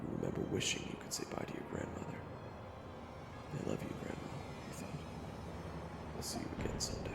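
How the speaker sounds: soft, near-whispered last moments; hushed narration. A narrator's reading. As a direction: tell it very softly, like final thoughts. Narrator, hero's last seconds.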